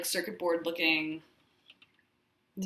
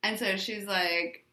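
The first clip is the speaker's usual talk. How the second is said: normal talking voice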